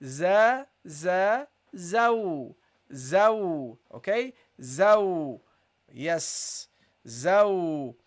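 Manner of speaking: za then zaw, rounding into the waw; Arabic teacher drilling zaw. Shown as a demonstration. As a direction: sound out za, za, zaw. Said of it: "attach it to the waw".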